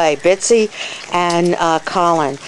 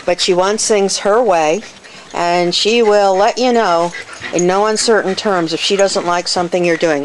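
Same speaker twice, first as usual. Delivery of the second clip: ordinary delivery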